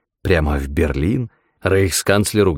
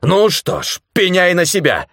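shouting; 12 semitones higher than usual. A narrator's reading. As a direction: threaten with a shout. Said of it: "закричал господин Тагоми".

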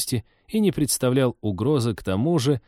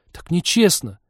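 put-on high-pitched childish protest; petulant little outburst, pitch up; childishly indignant; 9 semitones higher, 4 dB louder than usual. A narrator's reading. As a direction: protest like a wronged kid. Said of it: "поребячески возмутилась она".

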